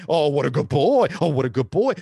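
loud, gushing owner imitation; mock dog praise. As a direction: gush over the dog at full volume.

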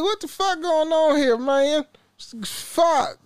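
high-pitched exasperated outcry; incredulous. Retold or imitated. Worker reacting to a thief never getting fired, incredulous. Retells disbelief he wasn't fired.

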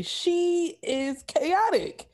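drawn out, very slow; slow emphasis. Real, not acted. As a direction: stretch every word of it. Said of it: "slow emphatic remark".

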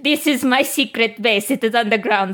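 put-on Russian accent, halting; mock Russian accent breaking into laughter; Russian superhero. Disguised hero explains the echoey secret base, mock Russian accent. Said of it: "Because I am Russian".

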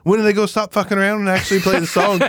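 high, fast baffled voice; mock-baffled high quick question; puzzled friend. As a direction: ask it like a confused newcomer. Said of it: "He's like".

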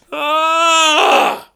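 high wounded shout; hammy cry of woe; anguished wail. Acted. Old Dick Lips outburst.

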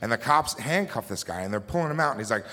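ordinary talk, nothing marked

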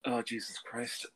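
slow, soft, exasperated groan; weary disbelief at the question. Real, not acted.

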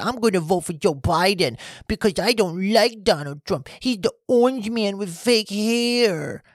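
high, whining mock-baby voice; mocking babyish whine; whiny baby. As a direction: mimic them as a big whiny baby. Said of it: "You sounded like a fucking baby".

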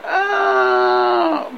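long, high held "aah"; mock scream of someone hurled away; falling scream. Knight thrown from the bridge.

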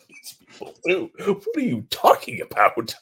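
high-pitched incredulous retort; exaggerated comic disbelief; mock bewilderment. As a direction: act out total bafflement, voice shooting up. Retelling his bewildered reply to mentor.

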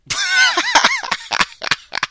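high, long laugh at himself; burst of laughter. Real, not acted. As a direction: crack up laughing. After his own singing.

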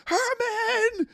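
high, drawn-out mock yell across the swamp; hammed-up wailing call to a son. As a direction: yell it out long and high like a frantic mother. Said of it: "yelling out to Herman".

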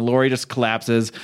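normal talking voice

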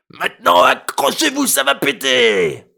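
put-on old man roaring, high and loud; bellowing. A narrator's reading. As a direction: bellow it out to burst eardrums. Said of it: "brailla à en exploser les tympans".

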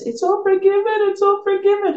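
high, tearful "it's all forgiven", lightly imitated; crying mother. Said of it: "And she's crying now".

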